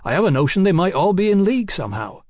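sudden inspiration. A narrator's reading. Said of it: "in a burst of inspiration".

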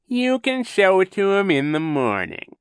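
high, loud, syrupy villain; falsely soothing. A narrator's reading. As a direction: soothe with obvious insincerity. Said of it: "said in a falsely soothing tone".

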